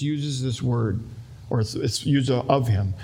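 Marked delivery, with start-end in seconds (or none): none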